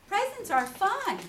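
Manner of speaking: put-on bright boy, up high and loud; cheerful young boy's voice; Christopher Robin voice.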